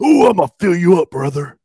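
high, loud wrestler boast; wrestling promo. Pretend. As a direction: ham it up like a pro wrestler. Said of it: "wrestling, uh, promo".

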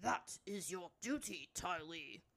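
put-on elder's voice, measured; solemn elder. Elder finally speaking up to the runaway princess, solemn. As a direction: remind her gravely of her duty.

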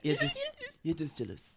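hammy mock Scottish; Scottish accent. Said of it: "you're just saying it in Scottish".

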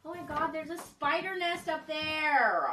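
loud, slow, high panicked cry; freaked out by a spider nest; scared shout. Real, not acted.

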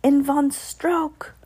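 put-on slow, high, accented witch voice; drawn-out, high witch declaration; Grand High Witch. A narrator's reading. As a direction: proclaim it slowly, v for w. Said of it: "thundered the Grand High Witch".

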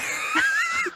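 very high, squealing burst of laughter; a real, high cackle at the memory; shrieking laugh. Real, not acted. Laughing at own frustration.

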